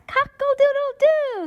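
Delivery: high, sing-song rooster crow. Acted. Reading to kids, crowing like a rooster. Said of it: "says the rooster".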